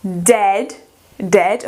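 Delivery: "dead" with a short open E; teacher modelling "dead" for students, short E. Shown as a demonstration. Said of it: "Mesma regrinha do E e o A".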